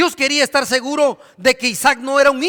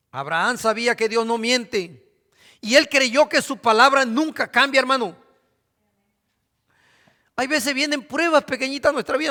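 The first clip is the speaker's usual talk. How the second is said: ordinary talk, nothing marked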